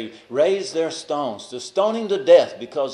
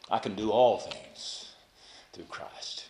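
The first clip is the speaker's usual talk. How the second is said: slow, then a whisper on through Christ; whispered resolve; 4 semitones lower, 6 dB quieter, slower than usual. Acted. Preacher voicing Paul's quiet vow, slowly dropping to a whisper. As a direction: drop to a breathy whisper at the end.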